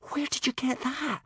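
gasping. A narrator's reading. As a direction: gasp the question in shock. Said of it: "gasped Hiccup".

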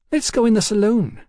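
high-voiced cheeky London girl. A narrator's reading. Hetty urges a drink.

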